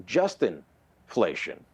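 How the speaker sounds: very slow "Justinflation" with the weight on "Justin"; emphasis on Justin. Retold or imitated. Commentator mimicking the MP's wrong stress: slow, heavy "Justin". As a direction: lean hard on "Justin" in "Justinflation". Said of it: "she put the emphasis on Justin".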